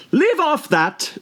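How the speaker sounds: high, very slow mock scream; screaming queen. A narrator's reading. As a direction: shriek at the bowing gardeners. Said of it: "screamed the queen".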